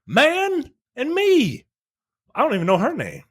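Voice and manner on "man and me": very high-pitched mock lover; hammed-up romantic plea, pitched way up; woman character's voice. Woman tells him she did it for them, high mock-romantic.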